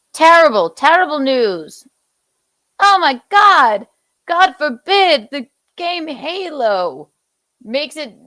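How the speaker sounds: high, loud, hammed-up outrage; sarcastic mock horror. Host mocking an upset gamer, sarcastic mock horror. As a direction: exclaim it as if the world were ending.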